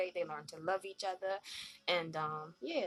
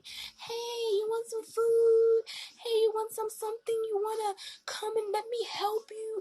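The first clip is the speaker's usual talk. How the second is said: mock sweet predator offering food; creepy luring voice; 14 semitones higher, 5 dB louder than usual. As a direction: coax like a stranger with candy. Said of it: "someone is coming to get you".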